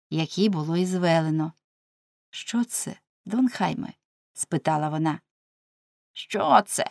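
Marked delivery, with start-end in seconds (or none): 2.3-3.9 soft, hushed timid question. A narrator's reading. Wife timidly asking her husband about the case, timid. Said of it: "боязко підійшла". 6.1-6.8 higher, put-on mimicking voice; mocking mimicry. A narrator's reading. Husband mocking his wife's question, mimicking. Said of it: "передражнив він її".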